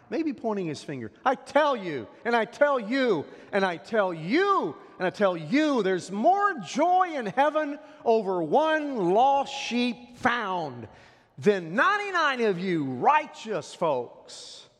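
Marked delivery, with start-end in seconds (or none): 1.2-10.9 high, loud preacher's proclamation; finger-jabbing, full-voiced insistence; emphatic pointed declaration. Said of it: "imagine him looking right in the eye". 11.4-14.7 loud, high, slow mock praise; needling the self-righteous; tongue in cheek. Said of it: "That was tongue in cheek".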